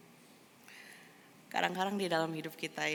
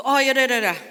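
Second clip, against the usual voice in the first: reluctant giving in; 7 semitones higher, 12 dB louder, much faster than usual. Retold or imitated. Believer giving in.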